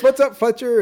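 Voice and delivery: high mock hail; imitated other guy. Other guy greeting Fletcher, imitated hail. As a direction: toss out the greeting well above normal pitch. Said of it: "then the other guy goes".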